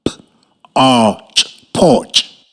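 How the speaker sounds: "P-" then drawn-out "arch", blended into "porch"; sounding out. Shown as a demonstration. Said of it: "Listen what I sound out the word".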